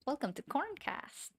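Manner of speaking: high, lifted show-intro greeting; mock podcast host. Streamer joking about her imagined podcast, mock host welcome. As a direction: sing out the show's name like a host. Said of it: "Hello and welcome to Corncast".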